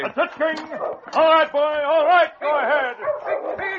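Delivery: high, loud, slow encouragement; loud urging cry to the lead dog. Acted. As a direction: cheer the dog past the rival. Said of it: "heard his master cry".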